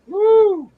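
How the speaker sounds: loud, high whoop of delight; excited whoop. Real, not acted. Streamer whooping as a viewer arrives, loud excited whoop. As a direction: whoop to welcome the raider.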